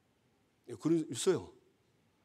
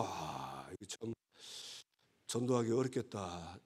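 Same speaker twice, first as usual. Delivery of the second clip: soft, low muttering under the breath; muttered to himself; 7 semitones lower, 9 dB quieter, slower than usual. Retold or imitated. Pastor thinking aloud about his confused grandmother, low mutter. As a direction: sigh it out as a private thought.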